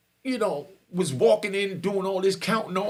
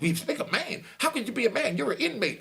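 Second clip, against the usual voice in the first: mocking counselor; 6 semitones higher than usual. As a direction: lightly voice the counselor looking down on an inmate. Counselor mocks his claim to respect.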